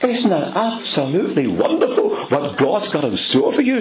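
delighted preacher laughing through the words. Real, not acted. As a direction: laugh with joy at the good news.

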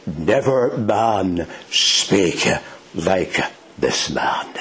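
very slow, measured, falling to a hush; slow, weighty, spaced-out words; solemn quotation. Acted. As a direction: let each word land, sinking low at the end. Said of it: "here's what they said".

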